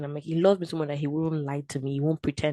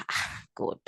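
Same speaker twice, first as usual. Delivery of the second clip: breathy whispered sigh to God; whispered despair; 5 dB quieter than usual. Retold or imitated. Losing the job, whispering to God in despair. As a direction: let it out under your breath, defeated. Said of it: "I was just like".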